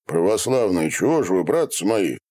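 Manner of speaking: usual speaking voice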